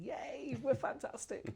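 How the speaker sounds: high-pitched little hooray; mock cheer. New president voices the city bandwagon, playful high cheer.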